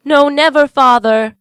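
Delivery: put-on children promising with all their heart; crying out. A narrator's reading. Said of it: "they all cried again".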